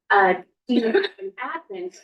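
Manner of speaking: usual speaking voice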